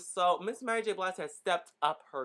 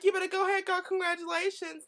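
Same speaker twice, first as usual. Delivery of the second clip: excited cheer; 12 semitones higher than usual. Retold or imitated. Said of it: "And I'm like".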